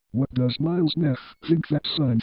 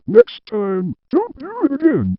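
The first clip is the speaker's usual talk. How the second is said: louder, much higher final warning; stern warning; 12 semitones higher, 4 dB louder than usual. Acted. Boss warns Mister Tim never to repeat it, loud and high. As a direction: scold it like a last warning.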